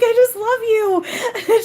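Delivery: overcome, telling her you love her; tearful. Real, not acted. Medium telling the spirit aunt she loves her, tearful. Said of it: "I'm like".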